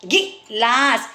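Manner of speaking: गिलास drawn out very slowly as गि लास; teacher breaking up a word; syllable by syllable. Shown as a demonstration. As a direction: stretch गिलास into its parts. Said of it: "तोड़ के दिया जाता है".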